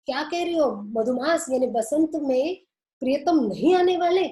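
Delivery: a listener's astonished retort; surprised question. Retold or imitated. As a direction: ask it back in mock disbelief. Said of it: "श्रोता कहते हैं".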